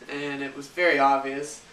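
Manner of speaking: usual speaking voice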